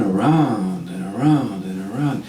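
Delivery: slow, looping "around and around"; drawn-out circling. Acted. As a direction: let each "around" stretch and roll. Storyteller mimics endless circling in forest.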